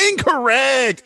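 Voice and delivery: high, loud "Incorrect"; excited verdict. Real, not acted. Quiz host gleefully calling the answer wrong. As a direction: announce it like a game show.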